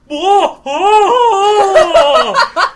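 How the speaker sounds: loud, high wailing whoa with a laugh; retold yell from the back. Retelling a worker hollering from the back, high and loud. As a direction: wail it out like someone in the deli. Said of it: "we hear in the back".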